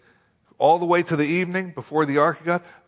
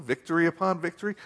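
usual speaking voice

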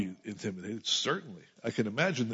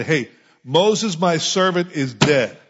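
God's loud announcement; 5 semitones higher, 8 dB louder, slower than usual. Pretend. God announcing Moses' death.

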